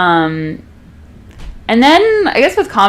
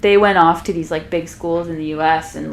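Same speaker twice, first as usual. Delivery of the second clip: normal talking voice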